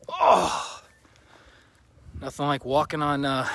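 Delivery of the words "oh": high, drawn-out moan of discomfort; pained groan. Real, not acted. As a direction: groan as sharp pebbles dig in. Walking barefoot on sharp pebbles.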